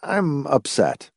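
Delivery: weary. A narrator's reading. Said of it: "he said wearily".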